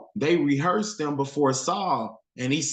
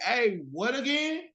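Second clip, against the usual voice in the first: higher, slangy retelling of the soldiers; casual street paraphrase; 8 semitones higher, 3 dB quieter than usual. Retold or imitated. Preacher voicing Israel's soldiers, casual and higher. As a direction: toss it off like a guy on the corner. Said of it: "He like".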